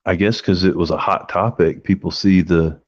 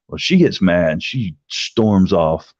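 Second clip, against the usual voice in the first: ordinary talk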